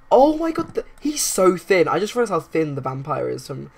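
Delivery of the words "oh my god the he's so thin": much higher and louder, stunned; startled gasp. Real, not acted. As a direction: react to a shocking sight. Spots the vampire sim.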